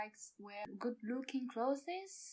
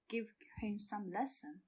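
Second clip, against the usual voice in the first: normal talking voice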